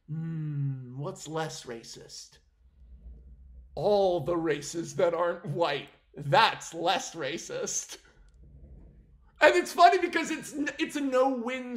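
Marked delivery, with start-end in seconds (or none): 0.1-8.0 a lower, slow, mock-thoughtful executive voice; pondering executive. Vlogger imitating the executives deciding, in mock thoughtful tone. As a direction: lightly play a suit reasoning it through. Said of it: "they're like".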